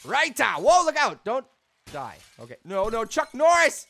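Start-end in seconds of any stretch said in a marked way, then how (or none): 0.1-1.5 loud, high warning shouts; alarmed yell. Real, not acted. Yells warnings during the game. 2.7-3.9 loud, high protest; alarmed shout. Real, not acted. Cries out during game mishap.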